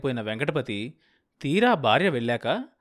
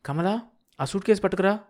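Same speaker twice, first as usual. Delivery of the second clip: calling out; 7 semitones higher than usual. A narrator's reading. Calling the maid.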